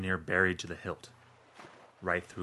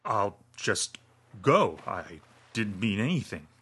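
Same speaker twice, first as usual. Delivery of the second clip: hitching, near tears; slower than usual. A narrator's reading. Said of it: "tries to make his voice soothing ... slow and hitching, like he's going to start crying".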